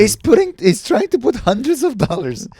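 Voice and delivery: high, excited teasing appeal; high playful appeal to the girlfriend; mock sales pitch. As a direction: hype it up for Claire like a pitchman.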